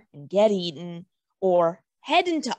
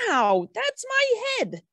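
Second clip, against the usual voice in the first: startled yelp; 12 semitones higher than usual. Acted. Shrub picked up roughly.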